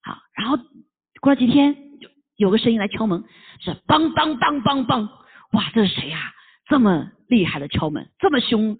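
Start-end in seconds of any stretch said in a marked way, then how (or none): 3.8-5.1 slow knocking sound. Retold or imitated. Someone knocks at the door.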